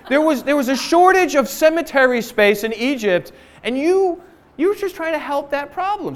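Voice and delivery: high, mocking complaint full of sarcasm; sarcastic whining Israelites. Israelites sneering at Moses by the sea, high sarcastic whine.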